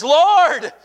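high, loud drowning man's plea; desperate cry for help. Acted. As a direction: call out for rescue, drawn high. Peter cries out while drowning.

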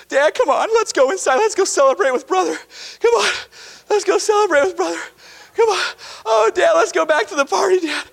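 high, quick and eager; eager pleading. Acted. Elder son begging his father to join the party, high and eager. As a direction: urge him inside to the party.

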